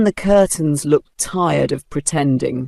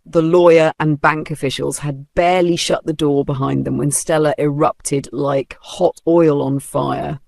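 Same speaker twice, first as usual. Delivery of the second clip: ordinary delivery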